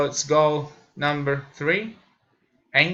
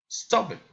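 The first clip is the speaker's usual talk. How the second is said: "Stop it" starts high and drops; falling intonation; 4 semitones higher than usual. Shown as a demonstration. Learner demonstrating commands, falling intonation. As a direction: let "Stop it" fall sharply in pitch. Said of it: "falling intonation".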